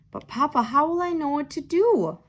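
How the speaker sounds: put-on a mild touch of a child's worry; worried child. A narrator's reading. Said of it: "Theo worried".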